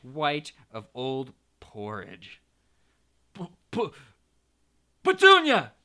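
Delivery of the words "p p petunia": stammer, then a very high, loud gasp. A narrator's reading. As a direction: choke out the name in shock. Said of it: "he gasped".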